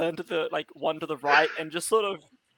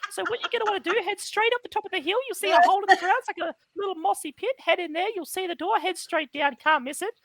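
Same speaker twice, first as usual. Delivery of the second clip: fast, higher Australian bogan voice; sassy bogan; 11 semitones higher, faster than usual. Acted. Tree dropping its act in a fast Australian bogan voice. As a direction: rattle it off like a cheeky Aussie bloke. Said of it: "Why does he sound like a sassy bogan?".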